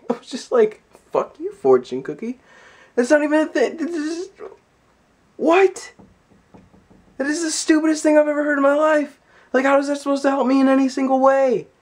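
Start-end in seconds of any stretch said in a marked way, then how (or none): 1.1-11.7 higher, fast indignant rant at a cookie; angry rant. Retold or imitated. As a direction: tell off the fortune cookie, fed up.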